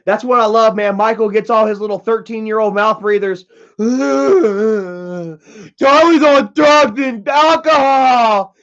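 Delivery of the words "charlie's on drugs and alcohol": slow, louder, higher sneering mimic; drawn-out mock chant in a higher voice; mocking teen voice. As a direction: taunt like a bratty kid. Mocking kids' taunt.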